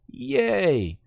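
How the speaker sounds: high, drawn-out "yay"; mock cheer. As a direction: throw in a fake celebration. Sarcastic cheer at weak changes.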